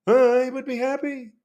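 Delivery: high, slow comedian impression; Jerry Seinfeld. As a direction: stretch it out high, sitcom-comic style. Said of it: "as Jerry Seinfeld would say".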